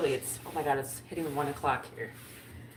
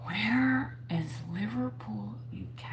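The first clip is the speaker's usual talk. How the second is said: slow whisper; 6 semitones higher, slower than usual. Real, not acted. Reading search aloud to herself.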